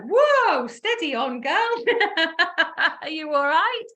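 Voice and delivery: high startled whoa, laughing through it; surprised whoa. Retold or imitated. Dave reacts to her demand, a high startled whoa with laughter. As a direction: rear back like he's reining her in. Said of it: "he was almost like".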